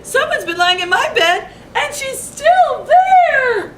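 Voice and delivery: a shocked little cub; Baby Bear. A narrator's reading. Reading to kids, Baby Bear finds Goldilocks in his bed. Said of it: "cried Baby Bear".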